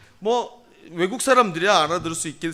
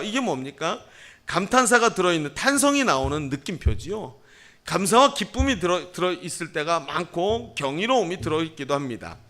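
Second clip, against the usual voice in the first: ordinary talk, nothing marked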